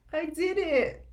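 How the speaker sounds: laughing loudly through the words; loud laugh breaking into a proud high cheer. Real, not acted. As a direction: giggle with relief at the tech. Laughing at tech working.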